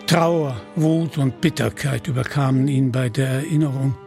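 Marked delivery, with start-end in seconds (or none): none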